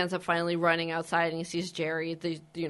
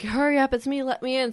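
higher, louder pleading at the door; mock freaking out; 7 semitones higher, 4 dB louder than usual. Ed begs Peter at the door to let him in, mock panic. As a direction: imitate a panicked plea to be let in. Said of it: "like, you know, freaking out".

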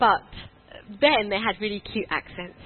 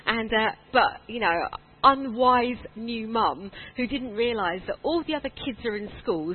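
ordinary talk

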